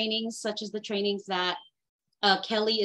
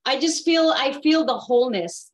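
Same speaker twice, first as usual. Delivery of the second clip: emotional, voice rising; 5 semitones higher, 6 dB louder than usual. Real, not acted. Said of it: "I feel like I'm getting emotional".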